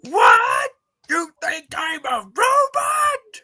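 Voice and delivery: high slow indignant yell; outraged shout. Acted. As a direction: bellow in disbelief. Acted SpongeBob argument.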